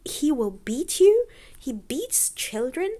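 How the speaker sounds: high, loud disbelieving question; shocked. Acted. Wife reacting to the boys, high shocked disbelief. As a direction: recoil at the idea of beating children. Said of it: "was shocked".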